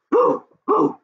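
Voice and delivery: ordinary delivery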